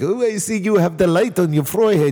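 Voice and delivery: put-on weak, half-hearted Indian accent. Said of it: "I can't even do a fucking Indian accent".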